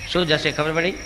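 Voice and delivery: usual speaking voice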